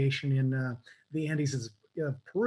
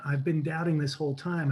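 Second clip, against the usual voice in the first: ordinary delivery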